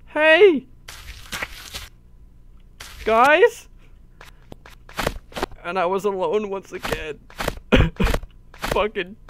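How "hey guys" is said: calling out after them. Real, not acted. Calling after departed players.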